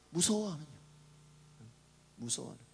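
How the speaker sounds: soft, trailing echo of a question; hushed rebuke. Retold or imitated. Preacher repeating Jesus' question to the disciples, hushed. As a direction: let the reproach sink to a murmur.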